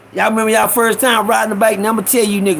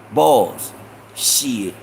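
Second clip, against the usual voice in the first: usual speaking voice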